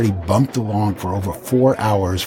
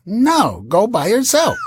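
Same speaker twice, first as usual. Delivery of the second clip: much higher, insistent mock wife; imitating the wife; 12 semitones higher, 3 dB louder than usual. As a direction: squeak it out like a fed-up wife. Wife voiced in quarrel.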